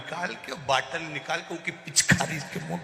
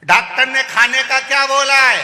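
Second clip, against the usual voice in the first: much higher, louder question; shouting; 10 semitones higher, 12 dB louder than usual. Retold or imitated. Deaf visitor asks about the diet, shouting. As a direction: bellow it at the patient.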